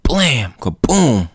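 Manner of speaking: punchy spoken explosion effects; comic-book blast noises; imitated blast. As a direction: voice a gunshot and boom.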